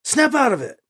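high, sharp command; young hero barking an order. A narrator's reading. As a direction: order him out of the trance. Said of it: "Alibi commanded".

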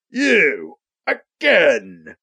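villain's stretched-out exclamation, only lightly shouted; mild character shout. A narrator's reading. Lava Lure recognizes Alibi, exclaiming in a mild villainous shout. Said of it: "Lava Lure shouts".

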